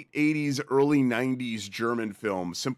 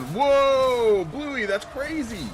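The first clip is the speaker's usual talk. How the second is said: surprised; 12 semitones higher, 4 dB louder, much slower than usual. Real, not acted. Surprised reaction to viewer.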